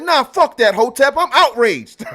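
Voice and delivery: loud, high-pitched indignant outburst; mock outrage. Streamer quoting his friend Shaw's outburst, mock outrage.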